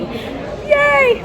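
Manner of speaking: high cheer; happy cheer. Real, not acted. Vlogger cheering outside the Mac store, high and gleeful.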